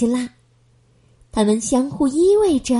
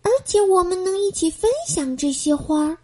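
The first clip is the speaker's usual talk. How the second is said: soft apology; 6 semitones higher, 3 dB quieter than usual. Acted. Apologetic girl voice.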